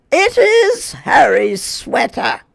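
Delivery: high, loud, slow surprised cry; Grandma realizing, astonished; exclaimed. Acted. Grandma discovering the nest, loud and astonished. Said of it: "exclaimed Grandma".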